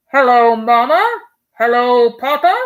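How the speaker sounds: explorer's cheery hello over the air; radio greeting. Acted. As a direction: ring out the greeting like a radio announcer. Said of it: "a faint voice floated out".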